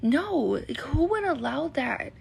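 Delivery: ordinary delivery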